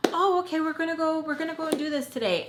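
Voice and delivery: high sing-song cover-up after hearing he cheated; fake cheerful. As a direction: chirp along as if nothing happened.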